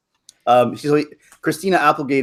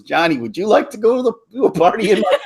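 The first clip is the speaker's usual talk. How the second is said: awkward Steve Carell; 8 semitones higher than usual. Retold or imitated. Man turns to neighbour and asks him, awkwardly. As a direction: turn and ask the next guy, still awkward. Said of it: "turns immediately to the guy".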